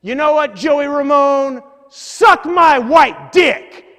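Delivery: high, slow, full-volume taunting yell; imitating a biker's drawn-out shout; biker's angry outburst. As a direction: bellow an insult like a furious street biker. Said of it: "all of a sudden he just says to me".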